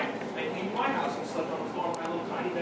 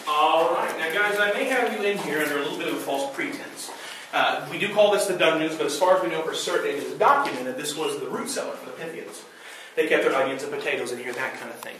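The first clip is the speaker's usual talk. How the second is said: ghost tour guide; 5 dB louder than usual. Acted. Guide addresses tour group.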